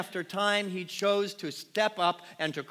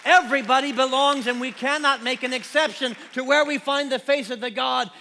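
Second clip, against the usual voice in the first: much higher and louder, crying it out; impassioned shout; 8 semitones higher, 10 dB louder than usual. Acted. Pastor proclaiming to the congregation that everybody belongs, impassioned shout. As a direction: proclaim it to the whole church.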